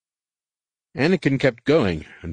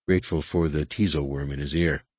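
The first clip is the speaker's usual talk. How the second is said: ordinary delivery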